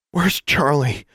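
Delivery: breathless, high gasp. A narrator's reading. Girl waking after a fall, gasping for her raven. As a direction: gasp it out, frightened. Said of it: "she gasped".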